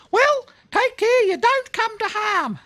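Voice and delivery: a tiny creature fussing grandly; chirpy cricket. A narrator's reading. Cricket stopping Blinky on the path, very high and squeaky.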